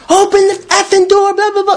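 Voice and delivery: 2 very high angry shout; 3 scream at the door in a rage; 1 raging yell. Retold or imitated. Punching the door, yelling.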